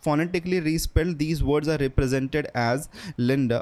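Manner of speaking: slow, careful read from the book; spelling it out slowly for students; slow reading aloud. Shown as a demonstration. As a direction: read each word out slowly. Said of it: "नीचे देखो".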